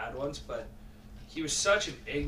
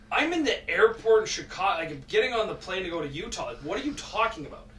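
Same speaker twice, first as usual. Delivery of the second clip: louder, then rushed and fast; retold exasperated shout; 4 semitones higher, 7 dB louder, faster than usual. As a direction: blurt it out, fed up and in a hurry. Retold angry reply to accusing coach.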